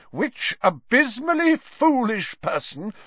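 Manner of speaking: high, slow, outraged demand; voice shaking. A narrator's reading. Professor demands the culprit from the crowd, voice shaking with anger. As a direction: draw out each word, building to loud fury. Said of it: "her voice shaking".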